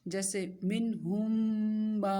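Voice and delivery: منہم ما read slowly with the م held and nasalised; nasal ghunna demo. Shown as a demonstration. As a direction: stretch منہم ما with a long nasal hum. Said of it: "غنے کے ساتھ پڑھتے ہیں". (غنہ کے ساتھ آہستہ)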